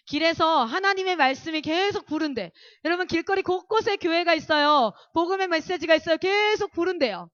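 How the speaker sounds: 점점 높아지는 설교조의 외침; 회중에게 힘주어 선포하듯 설교; 열정적인 설교. Acted. (힘 있게 선포하는 설교)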